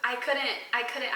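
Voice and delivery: ordinary talk, nothing marked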